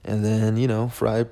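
normal talking voice

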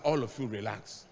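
low, soft and unhurried, a touch of a calm father; calm relaxed pastor. Retold or imitated. Spiritual father calming panicked pastors, soft and unhurried. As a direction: settle the panicking pastors gently. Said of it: "And the man is relaxed".

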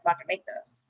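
hushed, hopeless panic mid-swim; despairing. Retold or imitated. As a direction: give up quietly under your breath. Panicking mid-swim, retold thought.

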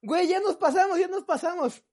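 scared. Retold or imitated. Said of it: "Enzo bien espantado".

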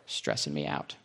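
low, soft mock-stressed grumbling; muttered grumble. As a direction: drop to a low mutter under the breath. Muttered grumble after GPS rant.

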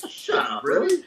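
breathy hushed shut up, then really shooting way up; stunned disbelief. Retold or imitated. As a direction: gasp it out, then leap high in amazement. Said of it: "I'm like".

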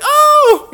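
very high, very loud pained oh; pained cry. Retold or imitated. Teller imitates the tased driver crying out. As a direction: cry out sharply in pain. Woman reacting in pain.

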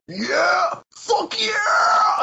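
high-pitched, drawn-out victory scream; wild mock victory screech; screaming celebration. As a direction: imitate a guy screaming in triumph. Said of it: "he's screaming so loud".

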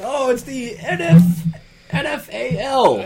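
high, slow European caricature; mock European. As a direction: draw it out in a silly high foreigner voice. Mimicking Europeans naming the league.

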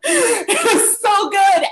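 words spilling out through a high laugh; laughing delight. Real, not acted. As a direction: laugh it out, overjoyed. Gushing about a moving song.

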